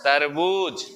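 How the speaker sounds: तरबूज with a long, falling बू; तरबूज sounded out for a pupil. Shown as a demonstration. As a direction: hold the oo in तरबूज. क्या बोलते हैं इसको.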